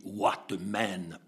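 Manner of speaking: low, slow New Zealand English; New Zealand accent. Retold or imitated. Hitchhiker's voicemail praising the bishop, New Zealand accent. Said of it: "un accent terrible de Nouvelle-Zélande".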